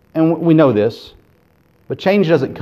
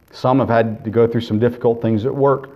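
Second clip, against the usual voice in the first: ordinary delivery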